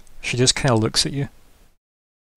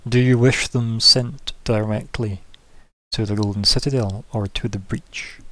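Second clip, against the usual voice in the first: smirking governor. Acted. Governor offers aid for the breach, measured and slow. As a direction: speak as a ruler concluding business, unhurried. Game master voices the governor.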